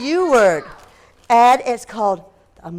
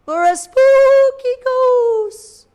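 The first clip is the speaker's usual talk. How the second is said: high, slow wavering ghost voice; spooky ghost; 14 semitones higher, 7 dB louder, much slower than usual. A narrator's reading. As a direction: moan it out like a ghost.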